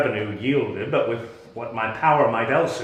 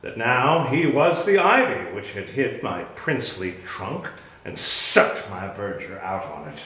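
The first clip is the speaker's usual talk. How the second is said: usual speaking voice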